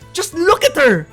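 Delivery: high, loud gushing shout; fangirling outburst. Real, not acted. Fan showing off Miku merch, fangirling outburst. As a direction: burst out in adoration, voice leaping up.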